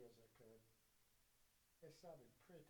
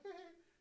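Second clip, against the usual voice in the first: mock high laugh; 9 dB louder than usual. Mocking the bookers' laugh.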